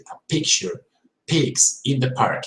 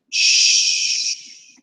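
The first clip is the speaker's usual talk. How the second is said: hushing shh. Shown as a demonstration. Teacher showing the sh sound. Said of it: "asking somebody to be silent".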